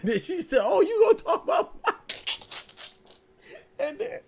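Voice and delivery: high, loud, breaking into real laughter; laughing. Real, not acted. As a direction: crack up while quoting her outburst. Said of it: "I'm laughing now".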